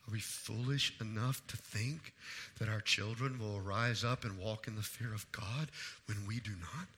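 very low, soft sermon voice; hushed, solemn preaching. Real, not acted. Preacher questioning parents about their example, hushed and solemn. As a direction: drop right down and ask it gravely.

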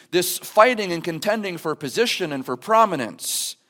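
ordinary delivery

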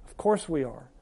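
usual speaking voice

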